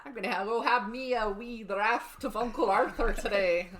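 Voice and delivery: a bad stage Irish brogue; mock Irish drinker ordering a pint; terrible Irish accent. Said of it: "That was a terrible accent".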